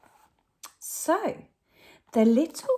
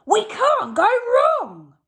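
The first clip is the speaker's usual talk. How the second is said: much higher and louder, a squeaky robber; robber Hugh; 8 dB louder than usual. Acted. Robber Hugh spots the singing whale, high and loud. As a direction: pipe it up like an eager crook. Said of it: "said Hugh".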